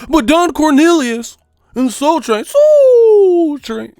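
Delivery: high, loud, slow mocking whine; mock pundit listing. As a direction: list the names like a clueless pundit.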